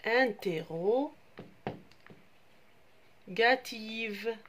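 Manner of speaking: interrogative stretched out syllable by syllable, very slow; slow teacher's model of "interrogative"; slow spelled-out word. Shown as a demonstration. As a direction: draw the word out so the children can follow each syllable. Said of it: "une phrase".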